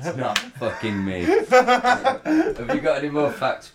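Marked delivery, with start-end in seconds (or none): 1.2-3.7 slow teasing. Real, not acted. Drawn out jokey question.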